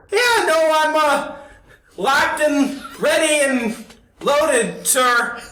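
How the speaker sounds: squeaky rookie answering the boss; nervous young guard. Acted.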